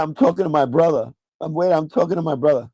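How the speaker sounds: ordinary delivery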